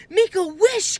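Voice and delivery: high, loud urgent cry. A narrator's reading. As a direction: urge him to wish now. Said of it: "cried Annie".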